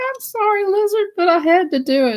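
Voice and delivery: high apology through giggles; high giggling apology; laughing apology. Real, not acted. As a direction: say sorry while cracking up.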